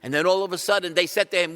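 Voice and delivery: ordinary talk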